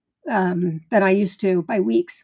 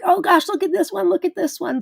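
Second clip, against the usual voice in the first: excited gushing; 8 semitones higher than usual. Retold or imitated. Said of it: "we're like".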